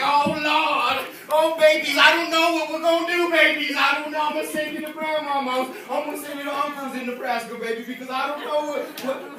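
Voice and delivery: loud, wailing distraught father; tragic daddy. Retold or imitated. A man in crisis wailing to his babies, loud. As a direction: panic out loud like a man with no plan. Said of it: "when a man gets tragic, he be like".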